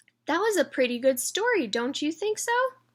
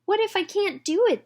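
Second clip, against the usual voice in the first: put-on worried girl; 9 semitones higher, 4 dB louder than usual. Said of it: "the little girl thought to herself".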